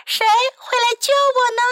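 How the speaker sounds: high, theatrical damsel plea; princess crying for help. A narrator's reading. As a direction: call out for rescue as a storybook princess. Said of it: "美丽的公主叫道".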